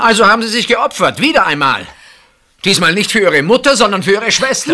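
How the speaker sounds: high, loud, cutting reproach; bitter accusation. Acted. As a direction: throw her sacrifice back at her. (bitterer, lauter Vorwurf)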